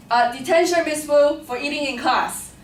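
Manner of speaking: teacher catching the student eating chips, mock stern and loud. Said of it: "you hear a voice".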